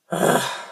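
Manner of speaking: pressured sigh. Shown as a demonstration. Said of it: "Put a little bit more pressure on it".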